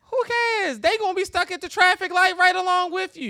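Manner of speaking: loud, high burst; preacher's loud who cares; loud exclaim. Real, not acted. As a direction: shout it out to the crowd.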